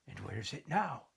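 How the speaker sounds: hushed, eager breathless question; breathless child's question. Acted. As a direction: ask it barely breathing, hooked on the story. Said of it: "David asked breathlessly".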